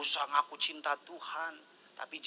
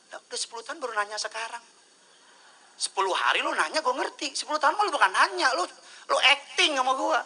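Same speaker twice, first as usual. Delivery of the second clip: higher, louder, fast incredulous scolding; exasperated retort; 9 semitones higher, 6 dB louder than usual. Acted. Pastor mocking a ten-year question, exasperated and loud. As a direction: throw it back in disbelief.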